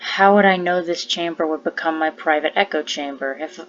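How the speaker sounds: low, steady, hushed poem reading. Real, not acted. Reads own poem aloud.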